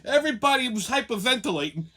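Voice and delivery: usual speaking voice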